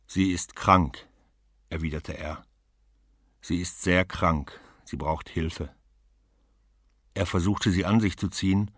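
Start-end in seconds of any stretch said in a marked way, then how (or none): 0.1-5.7 slow, heavy, deliberate; slow concern. Acted. Husband explains his wife is very ill, slow and heavy. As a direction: weigh each word about her sickness.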